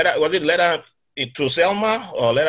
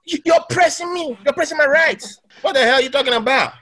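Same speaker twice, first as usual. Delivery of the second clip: Karen impersonation; 12 semitones higher than usual. Retold or imitated. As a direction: demand the manager, indignant and shrill-high. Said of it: "You did that Karen impersonation very well".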